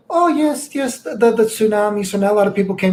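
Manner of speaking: high imitated old lady voice; mock old lady. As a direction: pitch up to play the lady. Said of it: "she's like".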